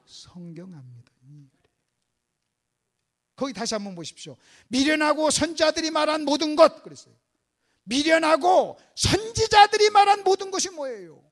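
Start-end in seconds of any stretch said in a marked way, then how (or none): 0.1-1.5 very soft, low, slow question; quiet acted reproach; gentle rebuke. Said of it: "뭐라고 책망했다고요?". 4.7-11.2 loud, forceful quoting of the verse; raised preacher's challenge; emphatic preaching. Real, not acted. Preacher hammers quoted verse at congregation.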